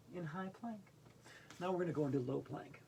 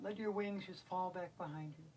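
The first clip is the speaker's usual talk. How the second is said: ordinary talk, nothing marked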